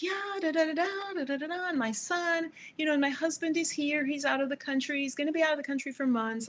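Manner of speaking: high, lilting impression of the chatty client; chatty client imitation. Client chatters on after massage.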